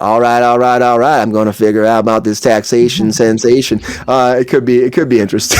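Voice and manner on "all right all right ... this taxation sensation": a hint of McConaughey's catchphrase voice; McConaughey impression. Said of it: "I surely doubt he'll say it like that".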